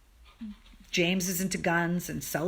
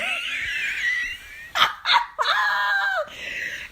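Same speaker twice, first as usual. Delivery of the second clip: real burst of laughter. Real, not acted. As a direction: crack up at the absurdity. Laughing at friend's blunder.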